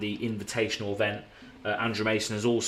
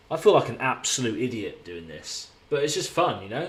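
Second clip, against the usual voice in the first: ordinary talk, nothing marked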